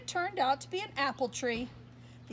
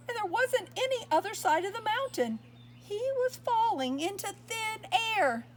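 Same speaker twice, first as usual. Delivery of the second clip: alarmed storytelling; 9 semitones higher than usual. Acted. Reader telling the child about Harold falling, higher and alarmed. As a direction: raise the pitch as the fall begins.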